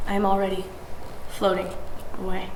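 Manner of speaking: ordinary delivery, nothing marked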